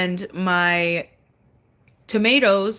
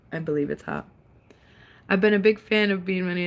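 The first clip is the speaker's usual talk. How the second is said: usual speaking voice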